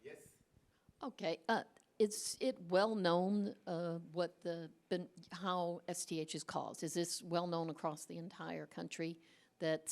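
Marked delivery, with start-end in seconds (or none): none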